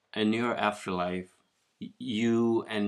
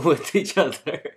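laughing; 7 semitones higher, 10 dB louder than usual. Real, not acted. Joking about three getting along.

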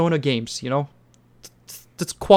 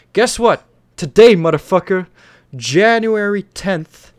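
loud, higher, hyped reveal; excited announcement; 4 semitones higher, 6 dB louder, slower than usual. Real, not acted. Host revealing a game's release date to listeners, excited. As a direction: break the big news with punchy excitement.

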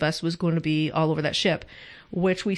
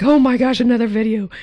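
louder, exasperated gosh; 4 semitones higher, 6 dB louder than usual. Retold or imitated. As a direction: roll your eyes as you say it. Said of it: "she's like".

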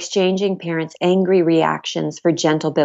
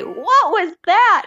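slow, much higher, alarmed retelling; retold shocked cry; 13 semitones higher, 4 dB louder, much slower than usual. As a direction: replay your own startled cry from the delivery bed, drawn out. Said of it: "you hear me in the background scream. And I say".

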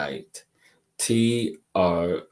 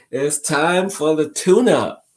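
big announcement; 8 semitones higher, 8 dB louder than usual. Real, not acted. Announcing the tune-up session.

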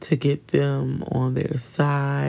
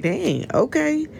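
much higher, sarcastic "okay then"; unimpressed; 12 semitones higher than usual. Retold or imitated. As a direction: toss it off high and deflated. Her own reaction to the one-upper.

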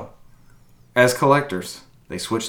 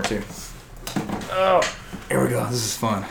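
ordinary talk, nothing marked

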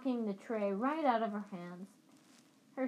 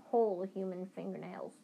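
plain reading voice

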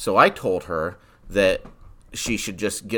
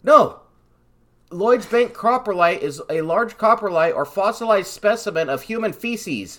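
emphatic correction; 10 semitones higher, 4 dB louder than usual. Real, not acted. Correcting confused co-host emphatically.

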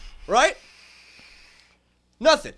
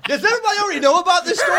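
fast, higher, breaking into laughs; host caught out by the crew, fast laughing question; 8 semitones higher, 7 dB louder, much faster than usual. Real, not acted. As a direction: rush it out while laughing.

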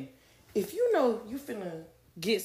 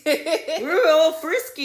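laughing; 8 semitones higher, 8 dB louder than usual. Real, not acted. Laughing while confessing.

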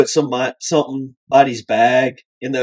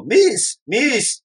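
passenger calling out; 10 semitones higher than usual. Retold or imitated. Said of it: "he could hear him go".

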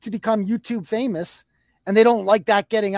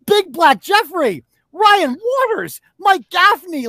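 names called out high and loud, slowly; scornful roll call; 7 semitones higher, 4 dB louder, slower than usual. Real, not acted. To his critic, jeering at his new crew, loud and slow. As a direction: rattle off each name louder and higher, drawn out.

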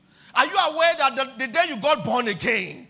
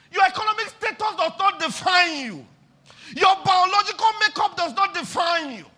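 loud, high preached climax; rising shouted preaching; preaching at full force; 7 semitones higher, 5 dB louder than usual. Real, not acted. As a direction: drive the truth home to the church.

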